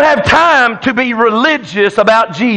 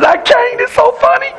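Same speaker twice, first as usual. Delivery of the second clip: much higher, squeaky, breaking with laughter; laughing friend; 11 semitones higher than usual. Retold or imitated. Neighbor on his knees, laughing too hard to help. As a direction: gasp it out through helpless giggles. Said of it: "And he says".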